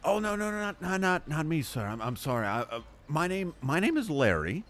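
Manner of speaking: flustered Larry. Acted. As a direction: babble an apology, caught off guard.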